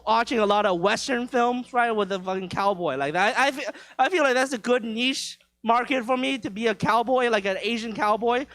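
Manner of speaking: usual speaking voice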